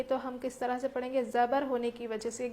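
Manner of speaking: ordinary talk, nothing marked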